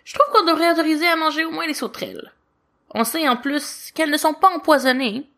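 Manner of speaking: put-on a young angel pressing his point, fast; lightly voiced little angel objecting; child angel Lucifer. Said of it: "objecte Lucifer".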